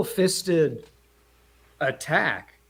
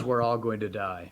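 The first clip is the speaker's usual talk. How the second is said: lower, softer matter-of-fact line; understated deadpan doom; flat calm statement; 7 semitones lower, 7 dB quieter than usual. Retold or imitated. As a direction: say it plainly, no panic. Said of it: "he literally says".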